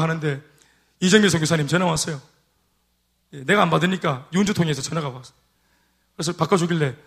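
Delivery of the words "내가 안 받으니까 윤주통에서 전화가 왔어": ordinary talk, nothing marked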